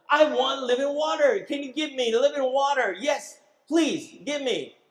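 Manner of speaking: a hint of the woman asking eagerly; eager plea. Samaritan woman asking Jesus for water, lightly voiced pleading.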